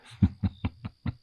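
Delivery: a run of small chuckles; amused chuckle. Acted. Dragon musing on two unlikely companions, amused chuckle. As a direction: chuckle in short, separate pulses.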